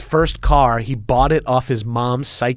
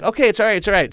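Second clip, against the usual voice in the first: higher-pitched, repeated calming of a friend; acted hurried reassurance; 8 semitones higher, much faster than usual. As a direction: reassure a panicking buddy, pitch up. Retelling calming his panicking friend.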